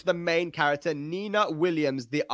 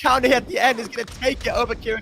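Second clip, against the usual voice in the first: slow, loud, drawn-out hype; victory call; 8 semitones higher, 3 dB louder, slower than usual. Real, not acted. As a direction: announce the winner big. Casts match win.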